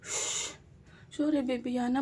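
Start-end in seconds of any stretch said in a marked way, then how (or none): none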